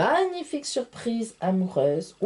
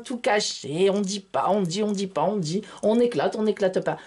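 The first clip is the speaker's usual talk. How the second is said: ordinary talk, nothing marked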